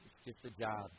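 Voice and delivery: flat indifference. Acted. Numb soldiers' indifference voiced.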